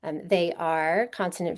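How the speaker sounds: ordinary talk, nothing marked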